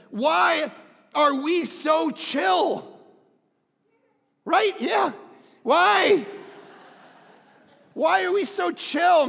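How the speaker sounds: loud, high preaching shout; crying out. Real, not acted. Preacher challenging a too-chill church, crying out. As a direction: shout the question at the crowd.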